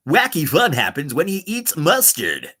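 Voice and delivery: bouncy, swooping promo read; upbeat radio voice. Pretend. Streamer voicing a silly mustard promo, playful upbeat announcer. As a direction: sell it as wacky fun.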